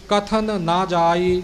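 both ਨ sounds in ਕਥਨ ਨਾ distinct; slow, ਕਥਨ then ਨਾ separately; careful clear recitation; clear pronunciation. Shown as a demonstration. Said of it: "ਵੱਖਰਾ ਵੱਖਰਾ ਬੋਲਣਾ ਹੈ ਜੀ".

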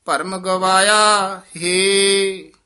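slow, drawn-out chanted recitation of scripture. Shown as a demonstration. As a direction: recite each phrase slowly and reverently, half sung. Teacher recites gurbani slowly.

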